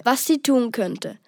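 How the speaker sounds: ordinary delivery, nothing marked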